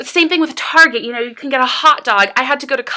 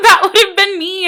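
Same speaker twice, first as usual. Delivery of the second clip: real sob-choked words; crying; 7 semitones higher, 5 dB louder than usual. Real, not acted. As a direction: say it through tears, moved. Crying over her past self.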